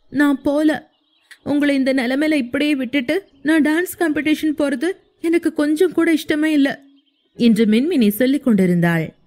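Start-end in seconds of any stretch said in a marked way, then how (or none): 0.1-6.8 put-on higher girlish voice, mildly tearful; young woman protesting through light tears; tearful pleading. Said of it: "மின்மினி அழுது கொண்டிருந்தாள்". (அழுகையுடன் கெஞ்சும் உயர்ந்த குரல்)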